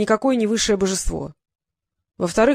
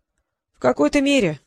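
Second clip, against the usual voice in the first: coyly higher, playing modest; mock-modest, a bit higher; 5 semitones higher than usual. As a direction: fish for a compliment. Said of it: "продолжал ломаться творец".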